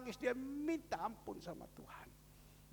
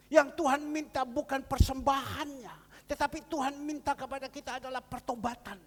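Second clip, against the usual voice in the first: loud, raised exhortation to the congregation; fiery preaching; 5 semitones higher, 10 dB louder than usual. Acted. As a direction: preach it out with rising conviction. Preacher exhorts congregation at full force.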